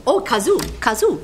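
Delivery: normal talking voice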